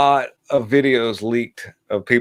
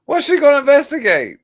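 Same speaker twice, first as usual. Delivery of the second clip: high, incredulous scoff; scoffing question; 3 dB louder than usual. Real, not acted. Streamer scoffing at Maxine Waters. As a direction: scoff at her claim. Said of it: "scoffing at the clip".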